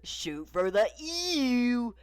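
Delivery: high, drawn-out toy catchphrase; slow, high cowboy toy voice; Woody. Acted. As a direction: stretch it slowly, rising high on the last word. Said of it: "Woody replied with".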